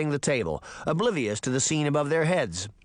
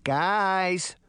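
higher, held-back call for attention; attention-seeking call; 5 semitones higher than usual. A narrator's reading. Daphne to her bickering companions, calling for attention. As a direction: try to break in, only moderately raised. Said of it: "trying to get their attention".

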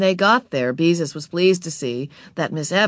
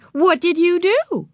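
put-on high, slow, loud question to a child; humouring librarian; 13 semitones higher, 6 dB louder than usual. A narrator's reading. Miss Evans asking Ramona what she did, high and slow. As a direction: ask slowly in a high voice.

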